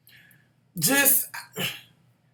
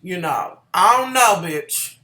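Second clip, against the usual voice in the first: ordinary talk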